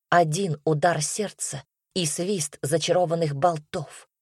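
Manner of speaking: plain reading voice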